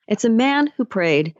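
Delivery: ordinary talk, nothing marked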